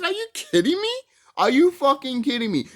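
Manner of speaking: high-pitched, indignant disbelief; acted outrage. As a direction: play the cheated moviegoer, loud and incredulous. Acts out his pissed-off reaction.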